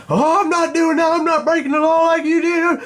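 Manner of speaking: high, loud whiny put-on voice; mocking the caller. Angry caller refusing to ship it back, high mocking imitation.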